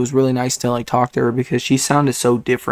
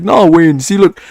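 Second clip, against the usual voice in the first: louder, higher imitation of a Jamaican accent; animated put-on Jamaican accent, raised and pushy; 4 semitones higher, 4 dB louder than usual. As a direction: imitate an insistent Jamaican friend. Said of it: "I have a friend that's Jamaican".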